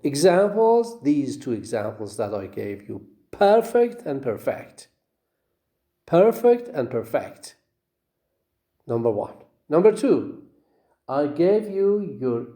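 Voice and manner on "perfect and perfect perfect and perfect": high PER-fect, then per-FECT; each pair: perfect stressed first at start, then at end; contrasting syllable stress. Shown as a demonstration. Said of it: "the stress is on the first syllable".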